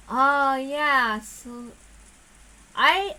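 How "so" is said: ordinary delivery, nothing marked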